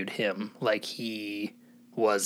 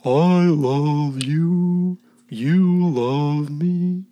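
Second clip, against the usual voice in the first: very slow, higher sing-song dinosaur voice; Barney the dinosaur; 3 semitones higher, 4 dB louder, much slower than usual. Retold or imitated. Taking his turn, he croons a slow kids' TV dinosaur. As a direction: drawl it out sweetly to the kids. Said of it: "so Barney the dinosaur".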